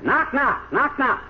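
high, light tapping knock; chirpy pecking knock; woodpecker knock. Acted.

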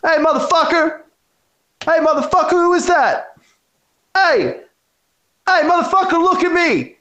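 very high mock holler; retold yelling. Storyteller voicing whoever yelled at him in the car, high mock yelling. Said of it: "I just hear someone yelling at me".

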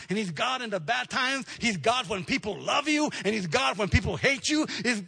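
the pastor's big sermon crescendo; full-force preaching. Acted. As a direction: preach it, hammering each line home.

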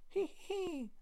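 high-pitched cackling hee hee; high witch giggle. Acted. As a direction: cackle like a ghostly hag.

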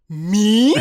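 loud, high mock-shocked question; comic offended outburst; incredulous. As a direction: blurt it out in stunned disbelief. Reenacts being accused of scamming.

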